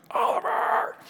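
high, loud yell after a runaway dog; re-enacted yell. Owner yelling after the dog that got off his leash, high shout. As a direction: call out the name at full pitch. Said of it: "I'm yelling".

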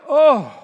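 sudden loud high "Oh" of being overcome; overwhelmed cry. Retold or imitated. Pastor voicing the stunned atheist, loud overwhelmed cry. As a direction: cry out as if about to collapse.

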